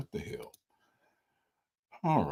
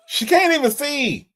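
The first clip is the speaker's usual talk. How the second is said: teasing exclamation; 7 semitones higher than usual. Real, not acted. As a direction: exclaim it higher, poking fun at her. Teasing guest who cannot see comment.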